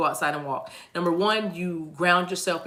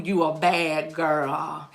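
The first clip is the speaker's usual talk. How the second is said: very slow, stretched-out compliment; drawled praise; drawn-out hype; 3 semitones lower, much slower than usual. Real, not acted. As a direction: drag out every word to hype her up. Hyping up the listener.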